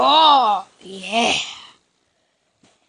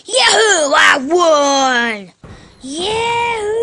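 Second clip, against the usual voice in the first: wild unhinged ranting; 3 semitones higher, 4 dB louder than usual. Real, not acted. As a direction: cheer like you just won. Winning the fight.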